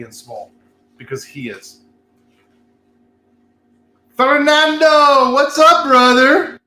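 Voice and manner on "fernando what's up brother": high, drawn-out shout of welcome; loud slow greeting. Real, not acted. Host hails a viewer joining, loud drawn-out greeting. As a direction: call out his name, stretched and loud.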